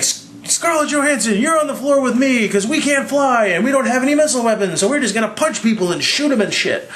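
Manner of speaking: lightly voiced Captain giving orders; bossy Captain America. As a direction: hand out jobs like a mock commander. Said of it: "uh, he's like".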